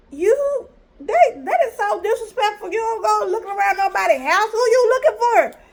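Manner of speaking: high-pitched, outraged telling-off; indignant scolding. Retold or imitated. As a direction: act out scolding a nosy guest. Scolding the man for snooping.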